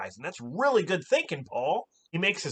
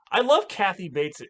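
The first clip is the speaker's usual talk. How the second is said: normal talking voice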